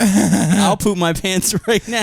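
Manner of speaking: laughing while quoting. Retold or imitated. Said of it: "I like the beginning where you said".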